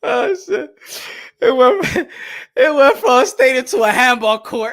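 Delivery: high, loud, choking with laughter; real laughing. Real, not acted. Mocking the stadium to handball court downgrade, high and laughing hard. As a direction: struggle to get it out through laughter. Can barely speak for laughing.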